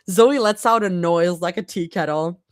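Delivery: ordinary delivery, nothing marked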